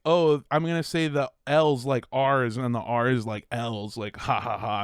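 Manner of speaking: low, dismissive mimicry of hack comics; mock a lazy comedian's bit with a fake laugh. Imitating hack comics who mock Chinese accents, low and mocking. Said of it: "they're doing it, the fucking hack".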